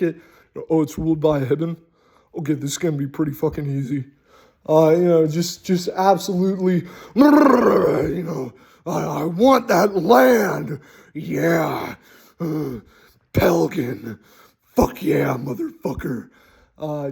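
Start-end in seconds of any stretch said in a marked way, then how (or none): 0.7-4.0 quick scheming. Pretend. Greedy city sizing up the town. 8.8-16.3 greedy conqueror. Pretend. Greedy conqueror coveting the land.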